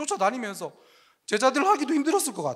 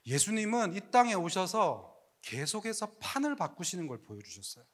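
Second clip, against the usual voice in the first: hushed preaching; 6 semitones lower, 11 dB quieter than usual. Real, not acted. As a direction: draw them in with a hush. Quiet preaching climax. (속삭이듯 조용하고 느리게 전하는 설교)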